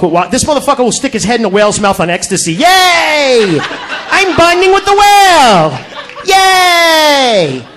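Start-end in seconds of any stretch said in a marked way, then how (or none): 2.5-7.7 high, slow, gushing mock delight; giddy thrill-seeker. Comic mocks a man in a whale's mouth, giddy and high. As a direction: gush like a wide-eyed tourist on ecstasy.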